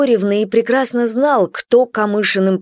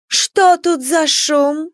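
haughty lady; 7 semitones higher, much slower than usual. Acted. Lady of the house enters.

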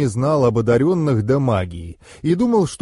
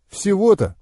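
high, quick incredulous question; surprised; 8 semitones higher than usual. A narrator's reading. Master hearing the guard's modest reason, surprised. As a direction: react with sudden surprise. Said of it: "удивился я".

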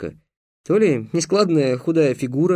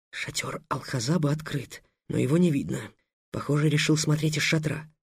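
much softer hushed aside; whispering; 8 dB quieter than usual. Acted. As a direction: whisper it backstage. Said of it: "прошептал". (тихий шёпот)

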